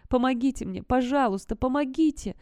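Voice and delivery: high-pitched pleading for help; Peter's voice. A narrator's reading. Creature mimics a boy to lure them, high plea. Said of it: "произнесло оно голосом Питера".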